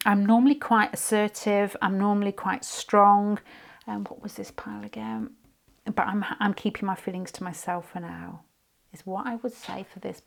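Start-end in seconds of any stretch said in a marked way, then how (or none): 3.9-5.3 muttered whisper to herself; whispered aside. Real, not acted. Reader losing her place among the cards, whispered aside. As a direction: whisper the question under the breath.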